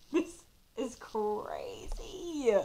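slow whisper; hushed disbelief; whispered awe. Real, not acted. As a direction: whisper it slowly, stunned. Overwhelmed by coincidence.

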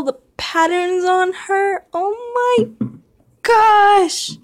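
very high, thrilled squeal; gushing delight. Real, not acted. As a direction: squeal with adoration. Gushing over the new model horse.

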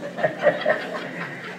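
une petite risque étouffée; breathy chuckle at own folly; soft laugh. Real, not acted.

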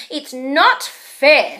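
loud, slow whine; whining complaint. Acted. Baby Owl complaining to Mum, loud whine. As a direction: complain loudly like a cross child.

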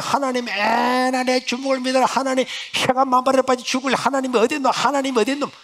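high, loud mocking defiance; defiant cursing. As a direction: sneer at God. Mother curses God. (하나님을 비웃는 어머니)

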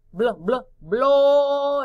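"Blo, blo, blo" hammered out, the last long and loud; isolated syllable repeated. Shown as a demonstration. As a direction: repeat "blo" and stretch the final one. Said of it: "a la frente o al final". ("Blo, blo, blo" repetido, alargando el último)

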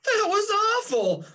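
high, loud exclamation of disgust; outraged cry. Acted. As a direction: blurt it out, still rattled. Raindrop arrives shaken at the lake.

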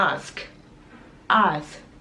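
teacher modelling ask slowly; careful pronunciation. Shown as a demonstration. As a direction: ask with both final consonants s and k clearly heard. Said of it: "คำนี้ออกเสียงดีๆ".

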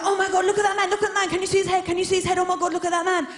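put-on high, loud, breathless child gawping; small kids thrilled at a punk; excited kids. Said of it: "me and my brother were like".

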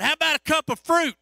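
loud, high call across a dark room. Retold or imitated. Preacher offering fruit to the grumpy patient: loud, high call. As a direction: call out cheerfully so he hears you.

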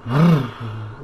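breathy engine rumble made by mouth; imitated engine rev. Driver showing how the Challenger starts up, imitated engine rev. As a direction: mimic a car engine turning over. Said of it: "I just turn it on and it's like".

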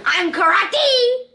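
high, loud, drawn-out mock karate yell; playful battle cry. Kid play-fighting with companion, mock battle cry. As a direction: stretch it out like a silly war cry.

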